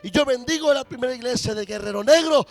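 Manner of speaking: high, loud, building blessing cry; fervent preaching shout. Acted. Pastor blessing Primera Iglesia de Guerrero Negro, fervent loud preaching. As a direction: proclaim it at full force over the church. Prayer rises to full preaching force.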